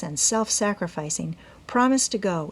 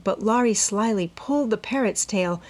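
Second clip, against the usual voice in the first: ordinary delivery, nothing marked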